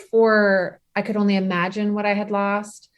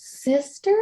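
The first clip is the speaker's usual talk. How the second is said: put-on a small child's questioning voice, higher; toddler voice, higher; 6 semitones higher than usual. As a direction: ask it like a toddler, lifting up. Toddler's word repeated.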